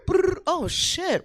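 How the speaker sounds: mock shiver then a startled curse. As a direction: act jolted by a sudden shock. Acting out a startled reaction.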